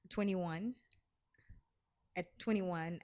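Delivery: ordinary delivery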